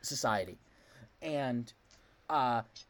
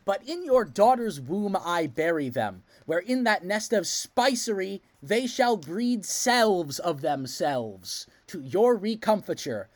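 slow, louder theatrical delivery; Richard declaiming; 5 dB louder, slower than usual. Retold or imitated. Richard answering the queen, slow theatrical declaiming. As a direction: declaim the lines like a stage villain. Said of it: "And Richard says".

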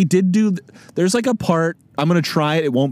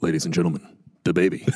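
much lower, softer deep announcer voice; Alec Baldwin impression; 11 semitones lower, 5 dB quieter, faster than usual. Doing Alec Baldwin introducing the baby on SNL, deep and soft. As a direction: drop way down and purr it like a TV host. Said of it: "beautiful, deep voice".

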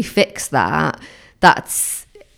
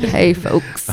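Ned Flanders greeting. Retold or imitated. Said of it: "Like, that's a bit Ned Flanders".

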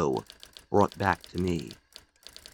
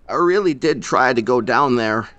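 coldly; 3 semitones higher, 6 dB louder than usual. Acted. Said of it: "he said coldly".

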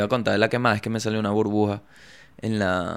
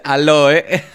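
mock phone answer; 3 semitones higher, 9 dB louder, much slower than usual. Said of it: "Y me dice".

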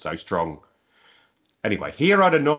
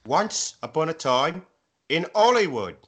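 announcing winner; 7 semitones higher than usual. Acted.

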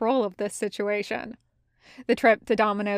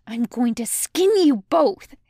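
raised, angry threatening voice; hissed threat; 4 semitones higher, 5 dB louder than usual. A narrator's reading. Eight two chasing the other two cadets, hissed angry threat. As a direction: threaten them both, higher and louder. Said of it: "Eight two's hissing".

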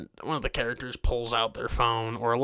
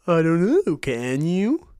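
lightly mocking a smart-aleck teacher; slightly raised, snide retort; smug teacher; 5 semitones higher, 4 dB louder, slower than usual. Mocking the teacher who answers a bathroom request. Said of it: "And they say".

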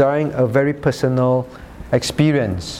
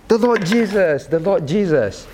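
put-on childlike adoration; 6 semitones higher than usual. Acts out clutching Bible like child.

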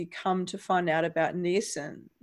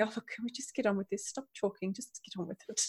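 fast, much softer muttered aside; impatient Aunt Pole; 12 dB quieter, faster than usual. Retold or imitated. Prim aunt to bantering men picking locks, quietly impatient. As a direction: hurry them along under your breath. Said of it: "see her standing there going".

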